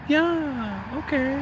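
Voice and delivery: high, soft, drawn-out sulk; slight pout. Retold or imitated. Manager answering the one who declined, mock pouting. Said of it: "Like, pouting a little bit".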